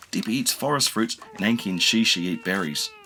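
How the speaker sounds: usual speaking voice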